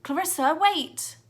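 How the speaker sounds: high, loud call; boy calling after girl; calling after her. A narrator's reading. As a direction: shout to stop her.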